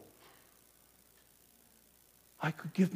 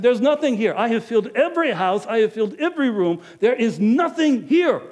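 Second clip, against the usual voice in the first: loud, high insistence; exasperated; 10 semitones higher, 8 dB louder than usual. Acted. Innkeeper loudly insists to Joseph every room is filled. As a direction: hammer home that there's nothing left.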